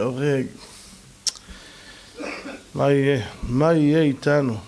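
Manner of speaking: normal talking voice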